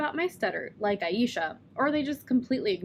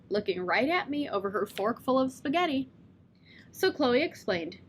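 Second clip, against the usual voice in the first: usual speaking voice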